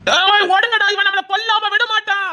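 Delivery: high, frantic call to run; panicked shout. Acted. Thug warns his gang to run, panicked high shout. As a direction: yell at friends to flee.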